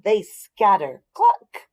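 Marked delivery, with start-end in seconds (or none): 1.1-1.7 high, loud angry cluck; mad chicken cluck. Acted. Narrator voicing angry chickens, loud cluck. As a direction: squawk it like a cross hen. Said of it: "The chickens get mad".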